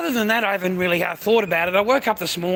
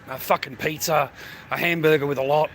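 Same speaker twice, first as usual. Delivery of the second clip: ordinary talk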